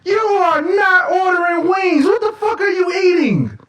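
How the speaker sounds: what are you even eating; mock disbelief. Mock outrage at the order.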